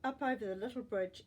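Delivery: ordinary delivery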